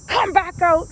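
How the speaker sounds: imitating coach shouting. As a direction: bark it like the angry coach. Coach shouting at crying girl.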